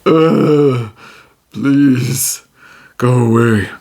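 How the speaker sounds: a queasy creature waving you off; sick orc voice. Acted. Nauseated orc telling Tijim to leave, miserably. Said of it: "And the orc says".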